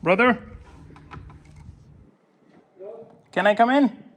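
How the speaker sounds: calling out. Real, not acted. Visitor asking brother to come in, calling out. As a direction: call through a doorway for permission. Calling to someone inside the fort.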